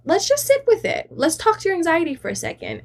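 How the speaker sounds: high, gentle coach voice; playful take on a calm therapist; imitating the coach. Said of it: "And he was like".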